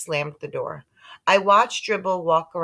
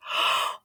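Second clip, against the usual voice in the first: gasp. A narrator's reading. Shocked gasp in story.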